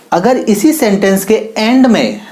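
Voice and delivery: usual speaking voice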